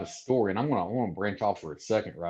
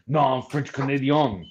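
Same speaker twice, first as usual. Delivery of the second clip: imitated French Canadian accent, drawn out in indignation; slow, mock-offended French Canadian protest; pissed French Canadian; 3 semitones higher, 4 dB louder, slower than usual. Navy man insists he is French Canadian, pissed French Canadian accent. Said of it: "he got super pissed".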